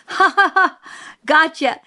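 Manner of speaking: high, loud acted cackle and taunt; gleeful shriek. A narrator's reading. Cecil jumping out at us in the dark, gleeful shriek. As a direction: shriek it in triumph, laughing first. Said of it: "he shrieked".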